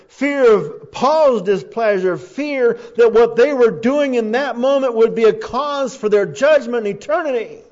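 loud, high, drawn-out key phrases; full-force preaching. Real, not acted. Preacher warning the congregation about fear of God, loud and impassioned. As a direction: thunder each phrase slowly at the congregation.

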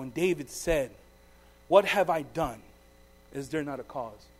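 measured Bible reading, falling to a hush; solemn scripture reading. Acted. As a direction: read the passage like a preacher at the lectern. Reads scripture aloud.

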